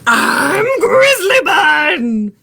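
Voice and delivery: high, loud, drawn-out roaring shout; bunny roaring her name. A narrator's reading. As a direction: roar it like a little bear. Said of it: "she roared".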